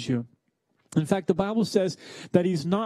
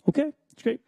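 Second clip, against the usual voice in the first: pretend okay; 8 semitones higher, faster than usual. Acted reply to silent wife.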